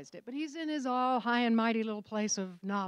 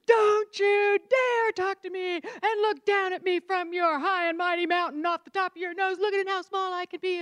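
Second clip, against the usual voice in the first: furious telling-off; 9 semitones higher, 7 dB louder than usual. Retold or imitated. Wife confronting husband over his tone, furious and loud. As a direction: let him have it, full of righteous anger. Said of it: "I marched over to him and I go".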